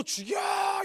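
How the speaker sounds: yelled way up high, like a sulking prophet; shrill mock scream. Preacher voicing Jonah's sulky scream at God. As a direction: shriek it like a tantrum.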